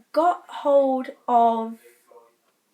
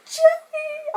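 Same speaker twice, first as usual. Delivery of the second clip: very high, loud delighted call of his name; excited shout; 6 dB louder than usual. Retold or imitated. Nana rushing up to greet Joey, a high excited shout. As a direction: shriek his name with glee. Nana pushes in, calls to star.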